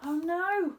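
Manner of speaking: gasp of alarm. Acted. Saucepan of soup knocked over.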